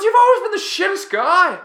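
very high, loud Aussie shriek; top-of-the-range screamed insult; screaming Marcus. Retold or imitated. Teammate raging at him over Mario Kart, screaming. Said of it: "Marcus screaming".

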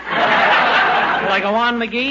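very slow, drawn-out gloating question; slow mocking laugh while asking; smug taunt. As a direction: savor it, laughing at him. Doctor gloating over the bill.

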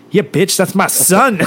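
cocky playground dad; mock proud brag. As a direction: gloat over your giant son.

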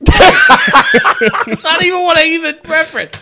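laughing. Real, not acted. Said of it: "laughing at walkie attempt".

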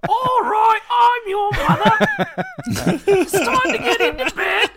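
very high, loud falsetto squeak, drawn out; shrill Python-style old lady falsetto; Terry Gilliam impression. Dad doing a high falsetto Terry Gilliam as mom for the kids. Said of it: "I do Terry Gilliam from Monty Python".

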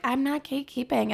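mock an innocent denial; fake denial. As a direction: pretend you're not hoarding it, coyly. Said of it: "when people gatekeep and they're like".

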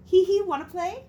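high squeaky toy voice. Retold or imitated. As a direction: chirp it like a talking toy. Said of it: "I ignore and keep going down".

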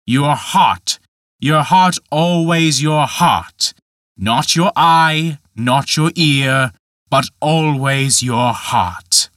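slow, each "heart" leaned on with rising force; a giant drilling his lesson in, word by word; emphatic mockery. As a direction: hammer home the word "heart" slowly. Michael's repeated emphatic mockery.

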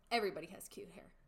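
ordinary delivery

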